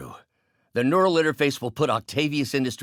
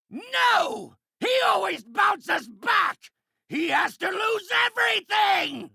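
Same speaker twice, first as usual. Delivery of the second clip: high-pitched howl of frustration; enraged shout. Acted. Villain demands rival lose everything, furious high shout. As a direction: shout it as if losing all control.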